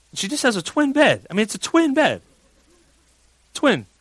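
high-pitched baffled protest; incredulous. Retold or imitated. Dad puzzling over his daughter's huge crash, incredulous. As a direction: insist on it in high, comic disbelief. Exasperated aside about the bed.

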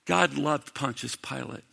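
dropped to a soft, quiet aside; quiet, reflective statement; hushed. Acted. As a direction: let it fall softly, almost to yourself. Preacher repeats key line, hushed.

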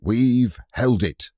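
slow, loud triumphant cry; Robert crying out in triumph. Acted. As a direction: savour the win out loud. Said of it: "cried Robert".

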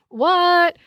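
high, loud What; exaggerated disbelief at the verses; a loud, high, incredulous What; mock shock. Feigned shock at contradiction.

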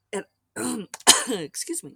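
clearing throat after choking on coffee. Real, not acted. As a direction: cough it out, a bit choked. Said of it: "that was choking on my coffee".

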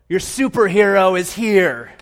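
loud heroic announcement; superhero entrance. Acted. Hero kicking in the palace door, loud proclamation. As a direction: announce yourself loudly, as if bursting in. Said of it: "kick the door in, and go".

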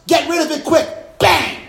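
loud, high shout building to a bang. Acted. Preacher shouting about swatting a mosquito, loud and high. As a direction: slap it down with the voice.

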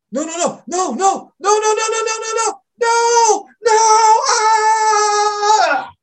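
high, loud screams of no; terrified screaming. Retold or imitated. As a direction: scream in pure terror. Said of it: "Great reenactment".

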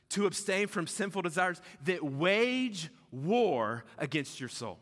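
slow and solemn, each word given weight; weighty scripture reading. Shown as a demonstration. Pastor reading scripture slowly for weight to the congregation. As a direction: let the verse land slowly and gravely. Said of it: "Listen how deep it is".

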